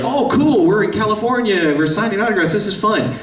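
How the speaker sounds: high, bright-eyed happy chatter; a lightly voiced upbeat co-star; cheerful colleagues. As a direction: sound thrilled to be there.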